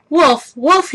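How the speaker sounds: loud high shout of alarm. A narrator's reading. Jack yells at the birds to trick them off. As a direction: yell it to scare the birds off. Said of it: "he cried".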